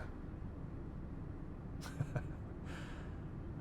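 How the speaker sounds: soft, breath-only laugh; low-volume amused snicker; quiet chuckle. Acted. Amused at own joke.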